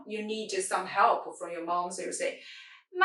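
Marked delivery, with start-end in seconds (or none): 2.9-3.1 MA held high and steady; first tone. Shown as a demonstration. Teacher shows learners a mom call, MA high and steady. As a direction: call out MA long and level, like to mom. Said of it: "like you call your mom in the yard".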